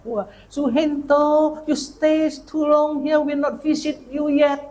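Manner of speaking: high, slow, broken-English church lady voice; visiting church ladies. Retold or imitated. Visiting ladies greet the pastor, slow high cheerful broken English. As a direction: coo it out slowly like a cheerful American lady.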